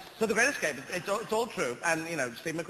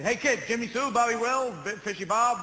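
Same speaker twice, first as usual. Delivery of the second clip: put-on calling kids, American; 7 semitones higher, 4 dB louder than usual. American dad calling his kids.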